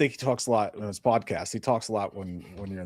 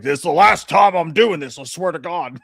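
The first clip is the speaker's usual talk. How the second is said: loud, slow exasperated vow; fed-up protest; 4 semitones higher, 7 dB louder, slower than usual. Retold or imitated. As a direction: groan it out like you've had enough. Daughter's character fed up.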